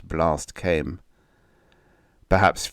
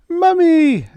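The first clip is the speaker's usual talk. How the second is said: put-on very high, loud child's shriek; 8 dB louder than usual. A narrator's reading. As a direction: shriek for mother as a little girl. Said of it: "Emily shrieked".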